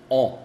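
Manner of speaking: on said back in the throat, nasal; a throaty nasal on; French an, nasal, from the throat; nasal an sound from the throat. Shown as a demonstration. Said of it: "On viene de la garganta".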